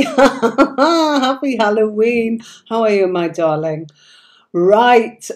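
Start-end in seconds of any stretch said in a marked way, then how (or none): 0.0-2.4 Happy Halloween through a laugh, high and slow; giggling holiday greeting to a friend. Real, not acted.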